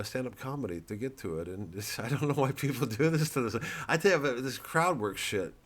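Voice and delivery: usual speaking voice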